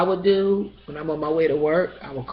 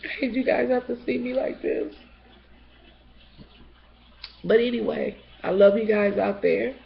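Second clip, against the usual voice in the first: tearful; 6 semitones higher than usual. Real, not acted. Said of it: "I'm a little tearful".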